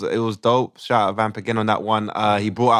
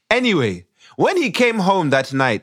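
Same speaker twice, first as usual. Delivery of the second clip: put-on Ugandan accent; 6 semitones higher than usual. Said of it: "we do it in a Ugandan accent".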